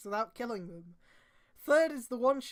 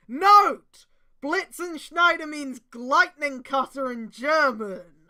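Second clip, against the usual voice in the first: mock announcement; 3 semitones higher, 12 dB louder, much slower than usual.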